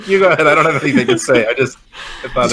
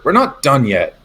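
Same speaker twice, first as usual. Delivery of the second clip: ordinary talk, nothing marked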